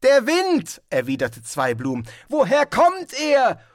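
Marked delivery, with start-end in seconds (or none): 0.0-0.8 high, loud shout. Acted. Tourist shouts over the storm. 2.3-3.6 high, loud shout. Acted. Tourist shouts over the storm.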